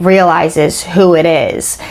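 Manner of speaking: ordinary talk, nothing marked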